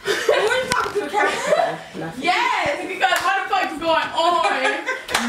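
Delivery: high-pitched, laughing through the words; amused high voice, laughing at her friend; laughing while talking. Real, not acted.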